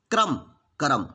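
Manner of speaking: क्रम then करम with the cluster opened; करम, extra vowel added; Khortha pronunciation. Shown as a demonstration. Teacher contrasting क्रम and करम. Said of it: "यहाँ पर भी जो भी अधूरा था".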